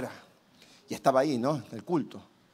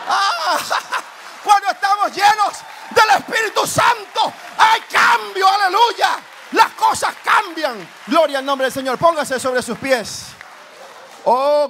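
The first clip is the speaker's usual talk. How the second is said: predicador gritando, mucho más agudo y fuerte; predicación fervorosa; 8 semitones higher, 7 dB louder, slower than usual. Real, not acted. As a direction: predica con fuego a la congregación. Predicación a todo pulmón. (predicación a gritos, aguda y fuerte)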